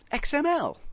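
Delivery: very high, startled cry; shocked exclamation. A narrator's reading. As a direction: gasp out the name. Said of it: "exclaimed C plus plus".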